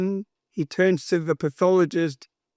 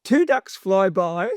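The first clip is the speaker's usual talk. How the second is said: usual speaking voice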